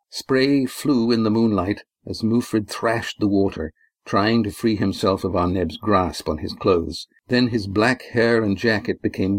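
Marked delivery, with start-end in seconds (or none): none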